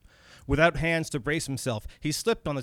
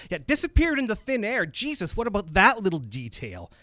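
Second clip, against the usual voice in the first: higher-pitched, incredulous questioning; 7 semitones higher than usual. A narrator's reading. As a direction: question it in startled disbelief. Character reacts in disbelief to vanishing.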